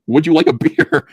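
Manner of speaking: hammed-up recruiter voice; mock recruiter offer. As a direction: dangle the beer like a bait.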